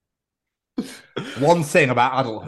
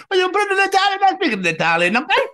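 high mock Italian reply; lightly hammed Italian. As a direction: slip back into the Italian voice. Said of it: "answering barista in character".

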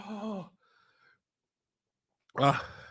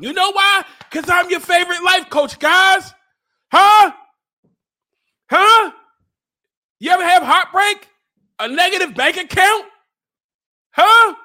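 high, raised, challenging shouts; hyped-up life coach; 6 semitones higher, 4 dB louder than usual. Acted. Life coach challenging viewers after adding a minute, hyped-up shouting. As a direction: fire questions out in a loud harangue.

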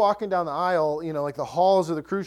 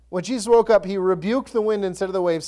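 normal talking voice

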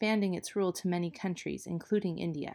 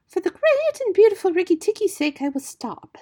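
high, loud singsong bird voice; high-pitched feather-brained bird; exultant songbird; 13 semitones higher, 9 dB louder than usual. A narrator's reading. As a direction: gush the news like a giddy bird. Voices Darzee the silly bird.

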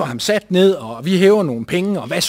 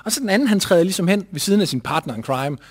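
normal talking voice

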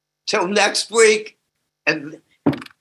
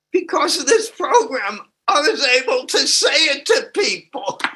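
real tears breaking through, voice pitched up; fighting back tears while sharing; choked up; 8 semitones higher than usual. Real, not acted. Emotional share at meeting.

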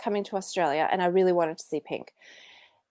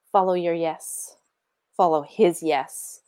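ordinary delivery

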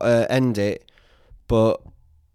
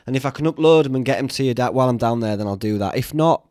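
ordinary talk

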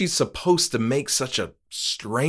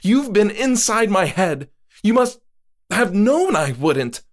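higher, louder woman's voice, accusing; Moira accusing; 12 semitones higher, 5 dB louder than usual. Acted. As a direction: throw the blame at him.

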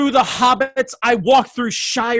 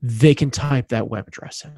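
dramatic announcer; 7 semitones lower than usual. Pretend. Hammed-up low announcer.

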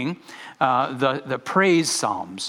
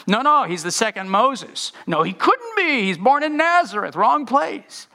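high, louder excited objection; arguing crowd; 9 semitones higher, 4 dB louder than usual. Acted. Crowd members arguing about Jesus, high and excited. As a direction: voice the doubters in the crowd.

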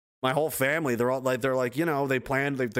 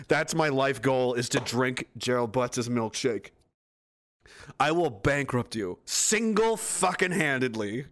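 normal talking voice

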